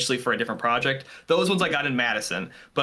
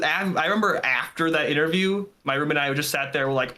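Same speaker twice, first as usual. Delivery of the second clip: usual speaking voice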